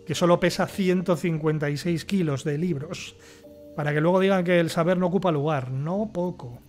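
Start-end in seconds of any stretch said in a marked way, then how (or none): none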